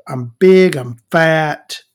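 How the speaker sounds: usual speaking voice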